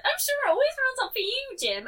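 high, loud mock-assured imitation; Jazz. Player quoted from a previous recording, high mocking imitation. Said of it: "Jazz was like".

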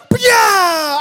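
loud high battle cry; karate yell. Retold or imitated. Dad chopping the goat, loud high karate yell. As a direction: shout it like a karate chop. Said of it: "I was like".

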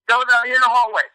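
loud, high scolding teacher voice. Retold or imitated. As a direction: bark at the kid like a stern teacher. Teacher kicking him out of class.

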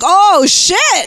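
sudden high "oh shit" realization; surprised. Retold or imitated. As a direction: catch yourself in surprise. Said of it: "and I'm like".

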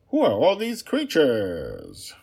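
high, slow, uneasy princess; wary princess. Acted. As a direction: ask slowly, uneasy, in the princess voice. Princess in the prison corridor.

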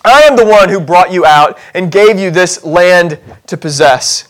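normal talking voice

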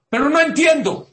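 high, loud mock objection; acted protesting cry; exasperated protest. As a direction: burst out as a baffled objector. Voices an objecting listener.

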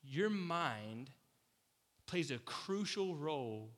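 ordinary talk

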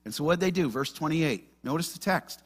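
ordinary talk

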